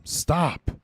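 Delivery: loud, high shouted command; screaming. Acted. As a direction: scream after a charging comrade to pull back. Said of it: "Adam screaming behind him".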